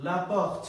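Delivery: "লা পর্ট" with a guttural R, like "la pokht"; French pronunciation. Shown as a demonstration. Teacher modelling French for learners, "লা পর্ট" with throaty R. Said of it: "এটাকে যেভাবে পড়তে হবে সেটা হচ্ছে পর্ট".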